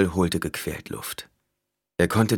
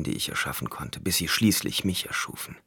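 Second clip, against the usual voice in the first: ordinary delivery, nothing marked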